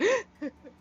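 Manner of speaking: sharp high gasp; acted startled gasp. [name] squeezing a friend too hard, startled gasp. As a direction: gasp as if caught off guard. [name]'s back-crack reaction.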